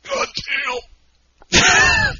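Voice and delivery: strained high croak of a gulp gone wrong; mock choked groan. Cohost mimics his choked groan after the drink. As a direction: imitate his gasping groan after chugging. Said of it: "That's a good soundbite. You're like".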